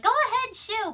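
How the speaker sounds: high-pitched brisk shooing; shooing away. Retold or imitated. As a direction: shoo off a pesky animal. Carl shoos the bird away.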